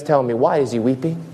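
ordinary talk

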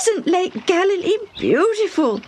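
put-on high, delighted girl exclaiming; excited little girl. Young girl exclaiming at the lake, high delighted voice. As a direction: gasp at the view like an awed child. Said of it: "Debbie exclaimed".

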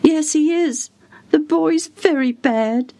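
high-voiced worried servant; anxious servant. A narrator's reading. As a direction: answer the caller high and troubled.

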